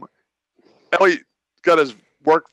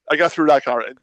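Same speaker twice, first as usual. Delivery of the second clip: ordinary talk, nothing marked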